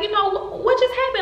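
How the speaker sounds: high-pitched, faltering worried question; imitating alarm on the phone; imitated scared mother. Mother asking her daughter, imitated fear. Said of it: "she's scared because she's like".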